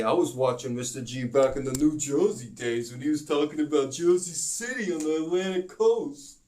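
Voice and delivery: a touch of a mock fan voice; mock viewer comment. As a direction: play an excited fan lightly. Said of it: "you can say".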